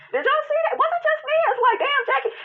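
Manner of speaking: excited disbelief. Real, not acted. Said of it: "stunned at Jackie's non-apology".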